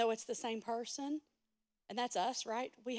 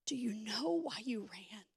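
whisper; 2 semitones lower, 6 dB quieter than usual. Acted. God's whisper continues.

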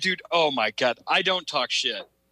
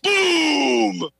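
high, loud mock battle yell; hammed-up shout of boom; 10 semitones higher, 5 dB louder than usual. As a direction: bark it out like a badass. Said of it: "like I was a badass".

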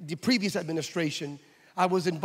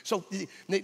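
ordinary talk, nothing marked

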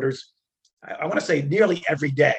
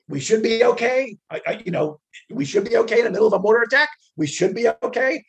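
high, loud disbelief; incredulous; 7 semitones higher, 4 dB louder than usual. Retold or imitated. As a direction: throw his words back at him, stunned. Incredulous at mortar attack.

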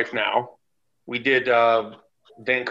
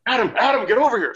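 high, loud call across the room; 11 semitones higher, 4 dB louder than usual. Retold or imitated. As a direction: shout for Adam to come over. Calling Adam over backstage.

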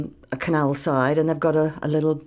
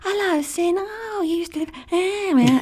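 high, lilting Essex voice, words going up at the ends; imitating Estuary English with high upturned phrases; Estuary English impression; 11 semitones higher than usual. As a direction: mimic an East London girl chatting, pitch rising high. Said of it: "they talk like that".

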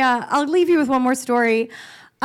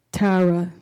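brother's line; much slower than usual. Retold or imitated. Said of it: "And he goes".

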